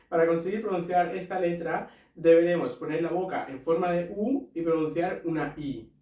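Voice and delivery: ordinary delivery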